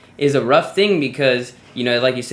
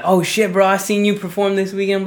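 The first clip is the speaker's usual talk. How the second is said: higher-pitched mock fan greeting; excited fan; 7 semitones higher than usual. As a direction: play a fan who just recognized someone.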